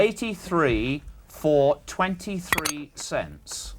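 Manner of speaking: very slow, measured figures; slow dictation. Real, not acted. Questioner dictating prices to the human calculator, slowly. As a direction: give each number time to be written. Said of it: "I'll say it slowly".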